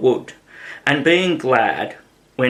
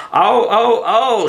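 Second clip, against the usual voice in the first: much higher, louder pained cries of oh; shouted cries; 9 semitones higher, 5 dB louder than usual. A narrator's reading. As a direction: wail out in sudden alarm. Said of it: "shouted the Queen".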